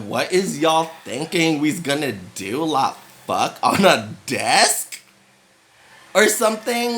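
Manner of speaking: incredulous rant. Retold or imitated. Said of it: "And I was like".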